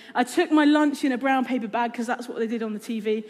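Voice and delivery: normal talking voice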